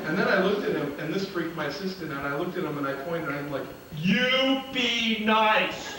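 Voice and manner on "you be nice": slow, firm warning with a laugh; stretched-out mock-stern order; stern scolding. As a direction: point and tell him to behave. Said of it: "That's exactly how I did it".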